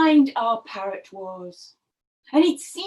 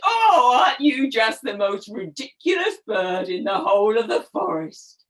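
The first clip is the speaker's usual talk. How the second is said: mocking birds; 3 semitones lower than usual. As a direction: taunt him like a snooty bird. Proud birds mocking parrot.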